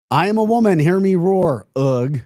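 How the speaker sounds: hammed-up mock roar of pride; mock proud woman. Reading a chat joke about her in a loud mock voice. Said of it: "Coming from the chat line".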